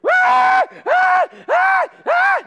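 high, loud terrified shriek; child screaming. Retold or imitated. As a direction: shriek like a frightened kid on a dark ride. Kids screaming on the ride.

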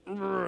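ordinary talk

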